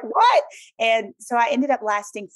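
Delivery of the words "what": high, louder mock-shocked "what"; shocked disbelief. Petite sportscaster told she'll be tackled, shocked disbelief. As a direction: yelp it in exaggerated disbelief.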